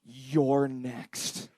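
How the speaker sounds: low, soft, deadpan menace; ominous caption reading. Acted. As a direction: read it like a quiet threat. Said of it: "it just read".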